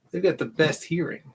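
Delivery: ordinary talk, nothing marked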